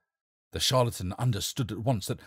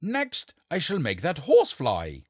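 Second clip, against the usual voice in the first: grand call, higher and louder; cried out; 7 semitones higher, 6 dB louder, slower than usual. Acted. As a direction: show off to the crowd. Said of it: "cried the king".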